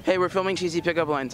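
usual speaking voice

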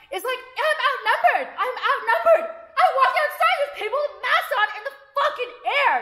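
high, heated rant; exasperated outburst. Real, not acted. Ranting to viewers about being outnumbered outside, exasperated outburst. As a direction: pitch it right up in loud disbelief.

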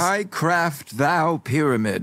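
high, drawn-out mock-olde declaration; mock-archaic proclamation. Workshopping an old-time pyramid line, mock-archaic. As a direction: proclaim it slowly, hammed-up and lofty.

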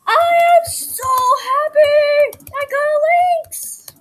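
high, loud shriek of joy; excited shriek. Real, not acted. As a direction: scream it out in delight. Shouting with joy over the Lynx.

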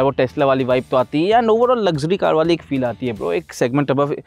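ordinary talk

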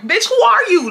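high-pitched, affronted question; indignant challenge. Retold or imitated. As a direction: challenge her, offended. Retold confrontation with the manager.

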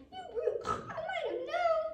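very high, indignant squeal; squeaky pig outburst; pig's cry. Acted. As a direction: cry out as an outraged little pig. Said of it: "Pig cried".

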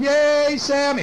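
high-pitched cheering crowd; party cheer. Acted. All the party guests greet Sammy, cheering. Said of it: "They're all going".

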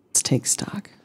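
breathy whisper to self; hushed aside. Real, not acted. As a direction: whisper it like a private note. Muttering to self while looting.

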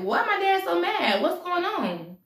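high-pitched, drawn-out puzzled whine; bewildered daughter. Acted. Daughter wonders about her angry dad, bewildered. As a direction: ask it high, as a confused child.

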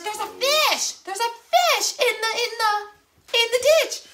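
high, thrilled pointing it out; excited. Retold or imitated. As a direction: squeal with discovery. Mom excitedly pointing out fish.

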